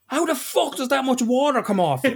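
high-pitched, incredulous complaint; exasperated disbelief. Retold or imitated. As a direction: demand an explanation in a high voice. Acts out his baffled complaint.

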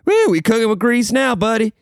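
put-on high, whooping good-old-boy voice; Southern coworker quote. Quoting the Alabama coworker's catchphrase, high whoop. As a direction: holler it like a cheerful Southerner. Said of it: "one time he was like".

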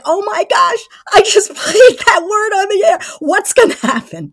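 high, loud, half-laughing panic; panicked. Retold or imitated. DJ realizing he aired a swear, mock panic. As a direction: blurt it out in mock horror. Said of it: "he was like".

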